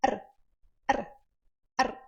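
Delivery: R, r, r as quick taps; short taps, no endless trill; r kept short; short tapped r. Shown as a demonstration. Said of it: "Não precisa fazer um R vibrante eterno".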